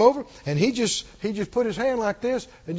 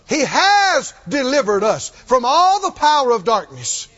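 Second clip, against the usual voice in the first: forceful preaching; 3 semitones higher, 6 dB louder than usual. Real, not acted. Preacher declaring signs to believers, forceful preaching. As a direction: proclaim it to the congregation, stretching key words.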